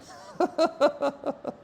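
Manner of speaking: high burst of laughter; real laugh. Real, not acted. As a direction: laugh it off after the pain. Laughs after painful rep.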